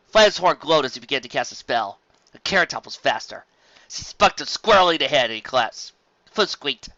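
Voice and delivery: ordinary delivery, nothing marked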